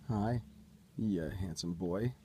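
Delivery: soft, slow praise for a dog; talking to a dog. Real, not acted.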